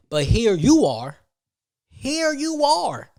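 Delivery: drawn-out, mocking "here you are" rising high; slow sarcastic reveal. Real, not acted. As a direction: savor the gotcha slowly.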